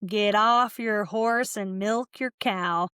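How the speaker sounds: very slow imitation Southern US accent; retold bad American Southern accent; mock Southern accent. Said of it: "it was also a Southern accent".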